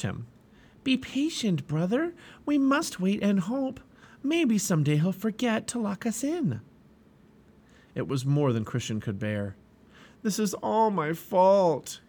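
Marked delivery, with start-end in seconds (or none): 0.8-6.6 a hopeful friend coaxing patience; gentle young pilgrim. Acted. 10.2-12.0 slow, higher anguished cry; a despairing pilgrim blaming himself. Acted. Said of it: "he cried".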